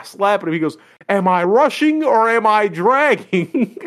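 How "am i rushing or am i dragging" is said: high, loud, drawn-out mock bellow; retold yelling. Band teacher confronting his drummer, imitated yelling. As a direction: imitate an enraged teacher, stretching out the question. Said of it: "he starts yelling at this kid".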